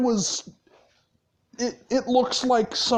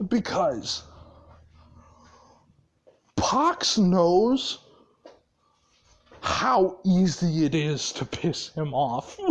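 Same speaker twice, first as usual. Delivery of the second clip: plain reading voice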